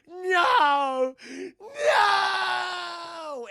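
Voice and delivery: high, loud drawn-out cry; hammed-up anguished no; horrified wail. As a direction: scream it out long and high in despair. Demona horrified at being human.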